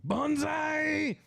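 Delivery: high, drawn-out yelled Banzai; yelled war cry. Retold or imitated. Gamer recalling Japanese soldiers charging at him, yelled war cry.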